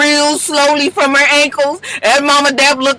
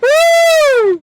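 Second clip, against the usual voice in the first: high whoop. Real, not acted. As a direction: whoop while cracking up. Host still laughing.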